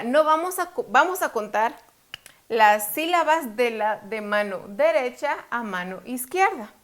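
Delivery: ordinary talk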